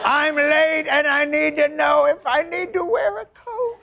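outraged, high-pitched comic holler; impatient viewer yelling. Acted. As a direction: yell at the TV news. Yells at local news clip.

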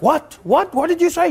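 can't believe his ears; baffled cop. Retold or imitated. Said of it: "this guy was like".

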